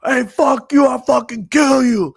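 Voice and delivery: put-on loud, high, drunken shout; imitating a belligerent drunk's yell; yelled threat. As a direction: bellow abuse across the street. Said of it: "this guy's yelling at people".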